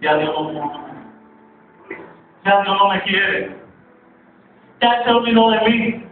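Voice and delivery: hushed despair swelling into a pleading outcry; lament building to anguish. Acted. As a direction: voice a believer who feels abandoned, rising in intensity. Acts out a despairing believer, building.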